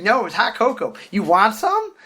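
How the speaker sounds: high mock-eager offer; mimicked annoying girl. Girl offering hot cocoa, high mimicking voice.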